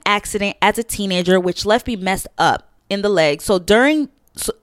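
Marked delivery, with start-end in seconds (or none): 4.3-4.5 losing the thread mid-read; stalling, words trailing off; brain freeze. Real, not acted. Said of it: "my brain is freezing".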